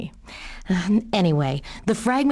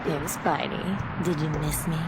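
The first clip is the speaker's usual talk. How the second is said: teasing; 3 semitones lower, 6 dB quieter than usual. Acted. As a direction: purr it at him like you caught him out. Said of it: "enough teasing".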